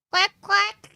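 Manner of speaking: loud, high quacking; loud duck quack. Acted. Louder animal noise.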